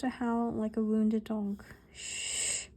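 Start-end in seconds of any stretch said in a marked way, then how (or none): 1.9-2.7 sharp whispered shush; hissed shush. A narrator's reading. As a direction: hiss it quietly. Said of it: "hissed Professor McGonagall".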